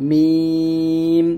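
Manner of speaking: মিম held very long on dry lips; drawn-out meem. Shown as a demonstration. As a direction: hum মিম slowly, lips closed. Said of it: "মিম দুই ঠোঁটের শুকনো জায়গা হইতে".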